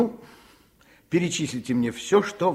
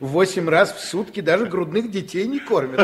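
indignant retort; 6 semitones higher, 8 dB louder than usual. Acted. Doctor scolds mother about feeding.

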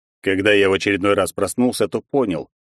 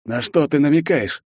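raised, puzzled question; surprised; 6 semitones higher than usual. A narrator's reading. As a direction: caught off guard by the hint. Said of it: "удивлённо спросил командир".

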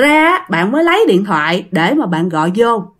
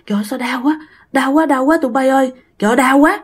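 groaning in pain; 2 semitones higher, faster than usual. Retold or imitated. Waking soldiers clutching faces in pain.

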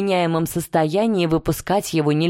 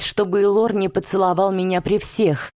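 ordinary delivery, nothing marked

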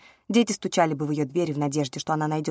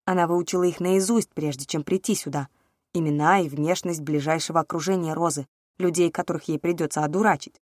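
ordinary delivery, nothing marked

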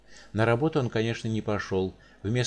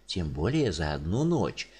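gloomy; 6 semitones lower than usual. Acted. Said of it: "мрачно добавил Волынский".

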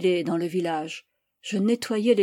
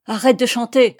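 loud furious command; enraged, almost choked; 3 semitones higher, 8 dB louder than usual. A narrator's reading. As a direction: order it in an enraged shout. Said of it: "intima encore l'enragé d'une voix presque étranglée". (ordre enragé, presque étranglé)

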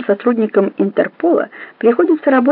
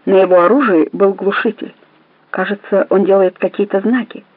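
ordinary talk

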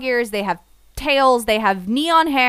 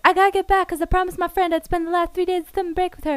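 high, rushed mock plea; hurried hero; 6 semitones higher, much faster than usual. Reviewer voicing the anime hero's excuse, hurried. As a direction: rattle it off like an urgent excuse.

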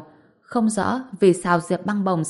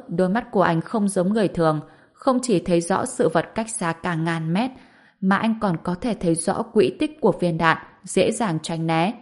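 plain reading voice

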